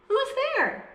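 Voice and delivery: very high startled squeak; a tiny mouse jumps at the glowing eyes; mouse cries out. Acted. Mouse calls into the dark den, high and startled. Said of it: "Mouse cries".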